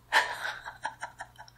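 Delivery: breathy run of whispered giggles; mischievous giggle. Acted. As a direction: laugh under the breath at a fooled victim. Demon mocking the victim.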